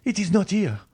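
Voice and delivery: grieving father shrieking while digging for his son. A narrator's reading. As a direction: scream it again, losing his mind. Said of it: "he cried".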